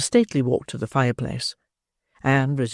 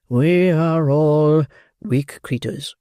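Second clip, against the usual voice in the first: slow amorous beadle; 3 dB louder, much slower than usual. Acted.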